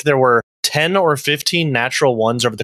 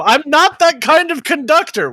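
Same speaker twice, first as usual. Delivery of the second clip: high-pitched indignant outburst; indignant protest; 12 semitones higher than usual. Acted. Orchestra conductor hired for the wrong job, indignant protest. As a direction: protest it in a much higher, aggrieved voice.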